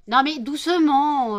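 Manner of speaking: loud, slow sharp warning; sharp scold. Real, not acted. Owner stopping cats fighting, loud drawn-out warning. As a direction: stop the cats with a loud command.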